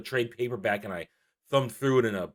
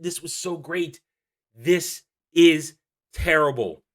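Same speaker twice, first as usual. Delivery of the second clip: normal talking voice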